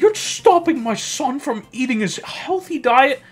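high, loud, huffy parent; indignant parent voice. Retold or imitated. Parent confronting the teacher at parent night, high and indignant. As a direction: bristle like an offended mother.

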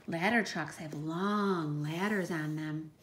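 ordinary talk, nothing marked

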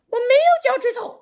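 high, loud puppet voice. Acted. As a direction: play a cheeky little puppet. Puppet Frankie answers.

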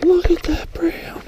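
breathless, hushed whisper of awe; awed whisper. Real, not acted. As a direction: whisper it so you don't spook the fish. Angler whispering at big fish.

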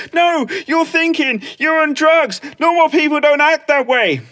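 high, drawn-out maternal scolding; accusing mum. Retold or imitated. Mum insisting Clem is on drugs, accusing. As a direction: mimic Mum refusing to listen. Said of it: "She goes".

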